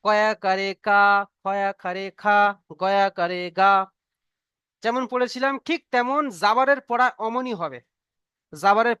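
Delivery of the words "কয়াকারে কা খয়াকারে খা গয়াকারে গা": singsong primer chant; reciting the primer. Shown as a demonstration. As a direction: recite like a child reading the alphabet book. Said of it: "আমরা বাংলা থেকে যেমন পড়েছিলাম".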